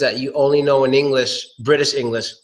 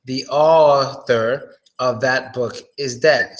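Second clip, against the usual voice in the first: slow model sentence; slower than usual. Shown as a demonstration. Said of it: "open your jaw".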